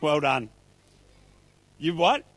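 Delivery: usual speaking voice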